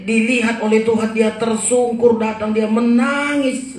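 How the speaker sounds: sustained high, pressing sermon voice; impassioned preaching. Real, not acted. Preacher defending the weeping widow to congregation, impassioned preaching. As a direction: preach fervently, voicing the complaint in passing.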